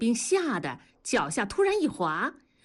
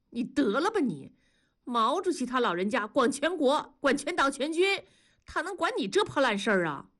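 higher-pitched, scoffing rebuke; scornful scolding; 6 semitones higher than usual. A narrator's reading. Wife dismissing her husband's oath, scornful. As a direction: pile it up, then dismiss him.

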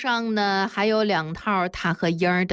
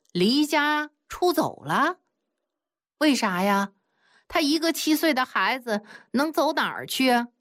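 astonished; 6 semitones higher than usual. A narrator's reading. Both men echo 小凤, astonished and higher. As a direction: gasp it back in disbelief. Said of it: "非常惊讶地看着小凤儿".

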